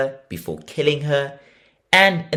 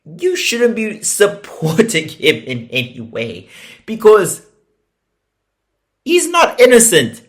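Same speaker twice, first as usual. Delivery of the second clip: scolding the family; 7 semitones higher, 9 dB louder than usual. Retold or imitated. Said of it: "Which I'm like".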